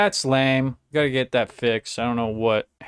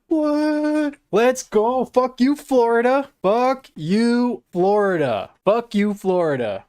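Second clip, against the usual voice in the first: ecstatic cheering; 11 semitones higher, slower than usual. Real, not acted. Streamer celebrating a goal against Florida, high triumphant yelling. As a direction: yell it in triumph.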